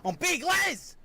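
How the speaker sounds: high proud yell of his own name; cartoon bogan shouting his name at himself. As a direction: announce yourself like a legend.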